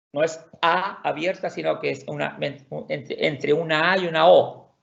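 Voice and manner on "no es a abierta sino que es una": usual speaking voice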